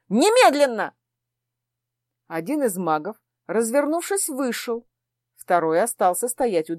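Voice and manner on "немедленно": a sharp, louder order; commanding. Acted. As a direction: snap out the demand. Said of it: "потребовала я".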